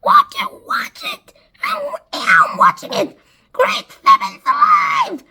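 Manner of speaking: high, excited squawking shout; frantic high cry of amazement; yelled. A narrator's reading. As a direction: yell it out in a shrill-pitched character voice. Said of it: "And Spiker yelled".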